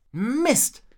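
high, loud burst of annoyance; frustrated curse. A narrator's reading. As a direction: curse out loud at a failed attempt. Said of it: "fluchte Rocco".

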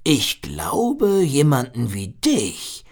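drawn-out, musing big seal; slow, wondering seal voice. A narrator's reading. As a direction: muse slowly as the old seal. Seal speaks thoughtfully. (langsam, nachdenklich wie der Seehund)

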